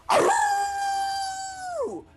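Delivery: big dog's howl, high and loud. Acted. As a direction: howl like a huge Saint Bernard. Saint Bernard ally howls.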